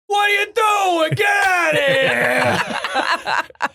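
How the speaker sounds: high laughing shout. Retold or imitated. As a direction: yell back through laughter. Retorting at hecklers.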